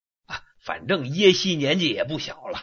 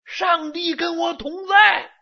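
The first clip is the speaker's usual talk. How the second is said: shout; 12 semitones higher, 4 dB louder than usual. Acted. Third brother proclaims loudly.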